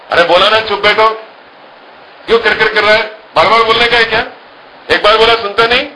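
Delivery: sharp scolding, pitch up high; a father snapping at a noisy child. Retold or imitated.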